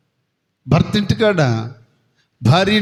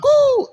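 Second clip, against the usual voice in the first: high hooting coo, like hide and seek; playful high-pitched hiding call. Acted. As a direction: call out a sharp high koo. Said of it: "అన్నాడంట".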